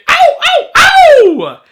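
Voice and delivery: loud, very high crow; crowing battle cry. Acted. Quoting Hook's chant for viewers, full crowing cry. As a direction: build to a huge, high-pitched crow. Crows the Hook film chant.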